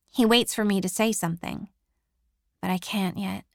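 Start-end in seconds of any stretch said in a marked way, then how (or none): none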